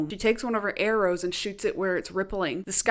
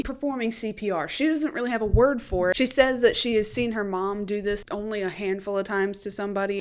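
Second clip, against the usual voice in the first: ordinary delivery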